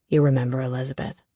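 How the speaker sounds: put-on man casually introducing his partner to an old friend; Declan's low voice. A narrator's reading.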